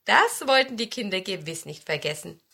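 usual speaking voice

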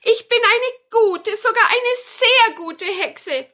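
very high, loud, slow witch voice; good witch. Acted. Witch assures grandmother that she is good, high insistent voice. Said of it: "sagte die Hexe Tannenmütterchen".

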